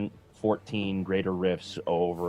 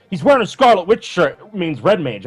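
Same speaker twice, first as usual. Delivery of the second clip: high, loud fan speculation; mock excited fans; 10 semitones higher, 8 dB louder than usual. Co-host mimics fans decoding a shirt, mock excitement. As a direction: imitate fans leaping on a clue.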